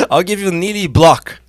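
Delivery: high, loud ring announcer call; announcer intro. Acted. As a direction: present the fighter like a big-fight announcer. Host introduces the guest.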